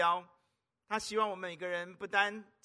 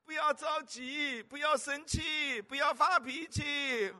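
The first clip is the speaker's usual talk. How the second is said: soothing mother; 6 semitones higher, 4 dB louder, slower than usual. Retold or imitated. Mother soothing child, laughing.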